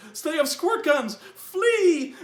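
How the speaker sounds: very high, loud cry of alarm; mock panicked shout. Players retreat from guards with squirt guns, shouting in mock panic. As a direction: imitate a panicked retreat, shrieking it out.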